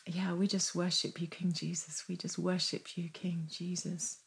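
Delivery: very soft, reverent prayer; hushed worship. Real, not acted. Worshipping King Jesus to close, hushed prayer. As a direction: sink into quiet, intimate adoration.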